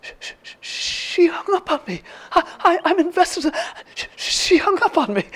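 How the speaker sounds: high-pitched stammering outrage; hammed-up indignant squeak, starting in a whispered stutter; comedian bit. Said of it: "comedian bit. She says".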